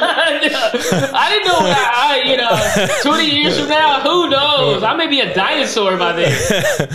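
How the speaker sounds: high, slow words broken by real laughter; laughing hard while getting the words out. Real, not acted. Laughing at twenty-year planning.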